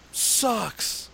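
high-pitched jeering yell imitating the crowd; mock crowd yell. Retelling the lacrosse crowd jeering each player, high mock yell. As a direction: jeer high like a whole stadium. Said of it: "the whole stadium yells".

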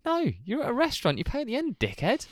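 high-pitched indignant scolding; comic outrage, voice pitched right up; exasperated inner rant. Retold or imitated. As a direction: tell off an idiot in your head. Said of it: "sitting there thinking".

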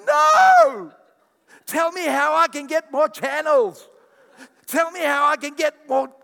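high, loud mock outcry of a couch potato; mock protest. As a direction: shriek like a lazy viewer refusing to budge. Acted couch lover protesting.

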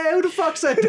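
mock indignant, high-pitched, through laughter; upset comic, retold. Host imitating comic stopping set, mock upset. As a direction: call out the heckler in laughing mock outrage. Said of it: "you got upset".

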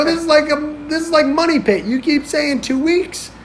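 high-pitched, indignant rant; exasperated complaint. Retold or imitated. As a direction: protest the endless stalling, acting it out. Retold quarrel over repeated delays.

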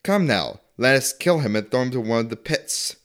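normal talking voice

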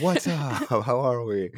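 high-pitched, hyped-up mock greeting; coming in hot. Host mimicking his own podcast opening, coming in hot. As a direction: burst in with exaggerated host energy. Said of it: "I think I came in a little hot".

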